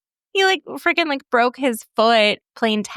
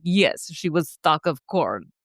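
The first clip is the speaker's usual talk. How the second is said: dad's dry reply; 2 semitones lower, slower than usual. Retold or imitated. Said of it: "he's like".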